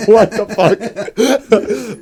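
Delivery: high, slow, laugh-soaked what the fuck; laughing disbelief. Real, not acted. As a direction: crack up while asking it. Laughing at absurd game scene.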